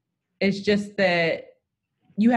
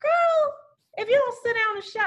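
sassy scold. Retold or imitated. Scolding [name] as a friend.

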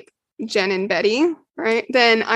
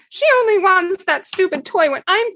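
put-on child's complaint; 8 semitones higher than usual. Betty voiced as small child.

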